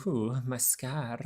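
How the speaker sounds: soft, slow mock Spanish accent, quietly groaning; a hammed-up Spanish-accented wizard moaning about his scar; Spanish Harry Potter. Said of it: "that's the Spanish Harry Potter".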